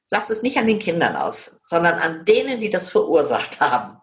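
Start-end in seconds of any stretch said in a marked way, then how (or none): none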